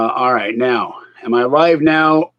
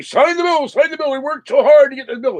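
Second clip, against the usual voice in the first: mock urgent plea, pitched very high; hurried, high-voiced imitation of politicians begging; pleading politicians; 12 semitones higher, faster than usual. Vlogger mimicking Republicans urging a signature, high mock pleading. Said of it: "The Republicans are saying".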